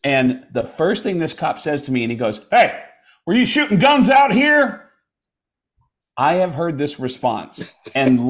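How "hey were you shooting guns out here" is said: high, loud mock cop challenge; confronting cop. Cop confronts a shooter in the driveway, loud and accusing. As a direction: call it out like an accusing officer.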